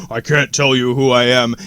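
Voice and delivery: low, disguised informant's voice; voice-mask voice. Acted. Shadow man with fuzzy face hiding who he is, low masked voice. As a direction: speak low as if through a voice mask. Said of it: "Yeah, the voice mask".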